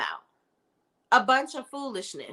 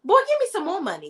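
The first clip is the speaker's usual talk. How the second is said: sassy demand; 9 semitones higher than usual. Retold or imitated. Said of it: "slapped him in the face and be like".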